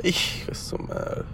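a low, hushed, breathy curse under the breath; muttered curse. Retold or imitated. Retelling the moment, a muttered curse under the breath. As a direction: mutter it to yourself like a private curse.

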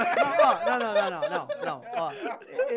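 high, laughing denial. Real, not acted. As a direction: protest through laughter. Laughing protest at teasing.

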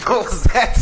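asked through a burst of laughter; cracking up while asking; laughing. Real, not acted. Laughing at the other speaker's Hola.

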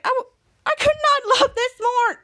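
high, teary-happy gush; high emotional gushing; overjoyed. Acted. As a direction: gush like you could cry from joy. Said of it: "Miles was like".

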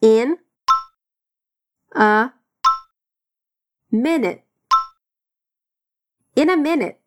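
In, a, minute each alone with pauses; slow separated drill of each word. Shown as a demonstration. Teacher drilling In a minute word by word. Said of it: "Bora repetir".

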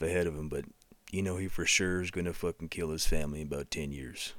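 deep-voiced western narrator selling beer; Sam Elliott impression. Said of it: "Sam Elliott would not do a commercial".

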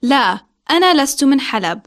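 normal talking voice

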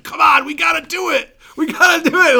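high, loud pleading cry. Retold or imitated. Steve pleading with the singer to keep touring. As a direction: beg him to change his mind. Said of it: "pleading with me".

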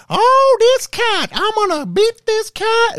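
very high, loud, drawn-out cartoon scolding; cartoon lady impression. Imitating Tom and Jerry's lady threatening the cat, high mock holler. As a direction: holler it slowly, way up high, as an exaggerated cartoon caricature.

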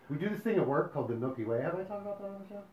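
normal talking voice

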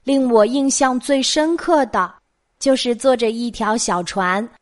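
ordinary delivery

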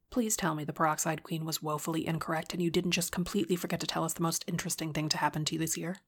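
words tumbling out fast; rapid-fire. Acted. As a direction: blurt it all out quickly. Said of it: "tumbled out of Bridget's mouth so quickly".

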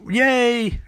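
high mock cheer; a quick squeaky team hooray; high-pitched celebratory yay. Team cheering at finished machine.

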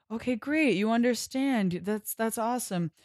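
lightly voiced eager cultists; mock cheerful captors. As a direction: sell it brightly like a cult recruiter. Captors cheerfully reassure Abe.

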